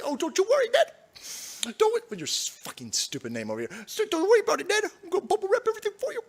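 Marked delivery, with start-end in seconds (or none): 0.0-2.0 high cooing mock mother; high babying mommy voice. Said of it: "while his mommy bubble wrap". 3.8-6.2 high cooing mock mother; high babying mommy voice. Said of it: "his mommy bubble wrap".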